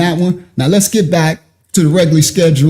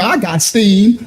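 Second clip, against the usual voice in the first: usual speaking voice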